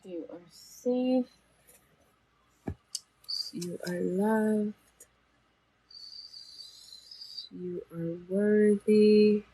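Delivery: gentle affirmations. Acted. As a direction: reassure them one phrase at a time. Closing affirmations.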